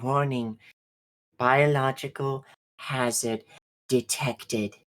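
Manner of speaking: halting computer alert; suit warning voice. Acted. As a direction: announce it word by word like a suit computer. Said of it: "all of your suits do the".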